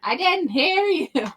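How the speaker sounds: high, drawn-out feigned innocence; slow, high-pitched oblivious reply; Alari not hearing. Acted. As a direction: stretch it out in a high voice. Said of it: "Alari in the head thing, like".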